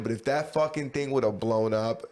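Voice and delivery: normal talking voice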